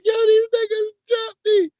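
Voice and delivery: put-on high, tearful wail of a crying kid. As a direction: sob it out like a scared little boy. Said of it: "I ran off crying and shit".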